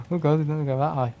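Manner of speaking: ordinary talk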